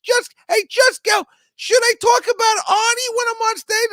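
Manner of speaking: very high squeaky little man asking loudly; shrill puppet voice pestering for advice; Mario impression. Imitating Mario asking about his stage act, very high and loud. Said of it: "That's the way he talks".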